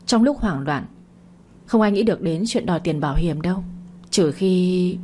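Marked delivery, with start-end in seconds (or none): 4.1-5.0 "Trừ khi" left hanging, then a long pause; deliberate breaking off. A narrator's reading. Woman hinting at suspicion to the couple, "Trừ khi" left suspended. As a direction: say "Trừ khi" levelly and stop short, sentence unfinished. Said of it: "Đoạn cô dừng lại".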